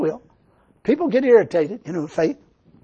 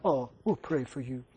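mocking pity; 6 semitones lower, 9 dB quieter than usual. Mocking churchgoers' response.

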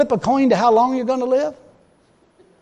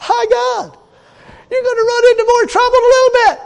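mock alarm; 7 dB louder, faster than usual. Mock exclamation, laughing.

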